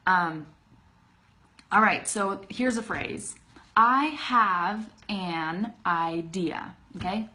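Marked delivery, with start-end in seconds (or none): 3.8-6.8 very slow, every word fully separate. Shown as a demonstration. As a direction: spell out each word slowly and carefully. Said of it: "it takes so much effort to say".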